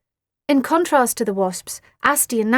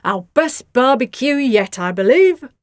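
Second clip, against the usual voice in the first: slow, louder satisfied voice; pleased with himself; dad praising the barbecue; contented dad; 3 semitones higher, 7 dB louder than usual. A narrator's reading. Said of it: "said Nellie's dad".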